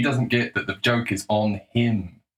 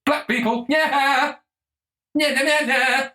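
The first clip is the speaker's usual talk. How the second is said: goofy comedian parody. Pretend. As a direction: squeal it like a silly crowd-pleasing comic. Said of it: "goes up on stage and goes".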